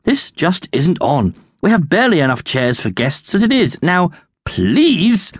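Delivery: stern butler's reprimand, rising in pitch; exasperated butler telling someone off. A narrator's reading. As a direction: scold firmly, climbing towards a high final word. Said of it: "the butler said sternly".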